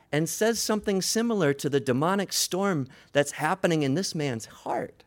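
normal talking voice

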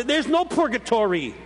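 mock angry retort. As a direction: snap it back like the angry young convert. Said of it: "See the voice, the tone of voice?".

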